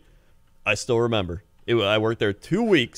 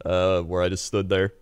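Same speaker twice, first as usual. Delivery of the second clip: normal talking voice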